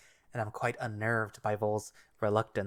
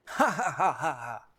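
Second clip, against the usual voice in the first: slow, hearty booming laugh; big pleased belly laugh; loud laugh; 5 semitones higher, 4 dB louder, slower than usual. Acted. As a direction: throw your head back and laugh loudly. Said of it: "The older male laughs loudly".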